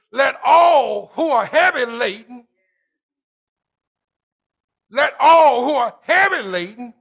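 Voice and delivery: rising call and response fervor; building sermon preaching. Acted. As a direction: preach it harder with every line. Preaching at full force.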